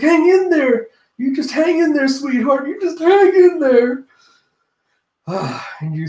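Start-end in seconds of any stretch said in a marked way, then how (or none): 0.0-4.0 very high, loud frantic encouragement; hammed-up falsetto fussing over a loved one; doting high voice. Ulti hugging, urging Pei Pei on.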